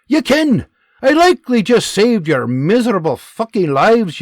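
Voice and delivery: loud, high Scottish barking orders; Scottish sergeant commands. Acted. Scottish sergeant barking orders at privates. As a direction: bark the drill orders, Scottish.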